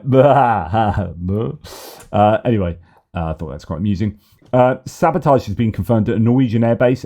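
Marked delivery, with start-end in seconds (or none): 0.0-1.5 a mock Polish laugh, first loud then dropping. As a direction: imitate his hearty laugh. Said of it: "And he's like".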